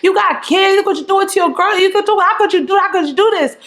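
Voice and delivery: high, fast accusing shout; scolding. Retold or imitated. Filming Leon and shouting at him about his girl. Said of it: "I'm saying".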